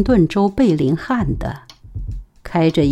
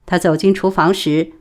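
usual speaking voice

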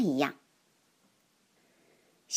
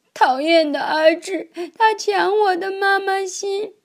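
put-on higher, louder tearful little girl's complaint; crying child; 11 semitones higher, 5 dB louder than usual. A narrator's reading. Little girl crying to mom about Azhi, tearful and high. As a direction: whine it through tears like a wronged child. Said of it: "一边哭着说".

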